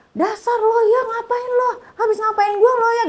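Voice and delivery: very high-pitched scolding, without yelling; retold furious accusation. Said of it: "pokoknya marah semarah-marahnya". (menirukan omelan marah, nada tinggi)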